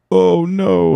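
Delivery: high, loud yelp of panic; mock panic. Caught asking about weed, he yelps in mock panic. Retelling his scared reaction.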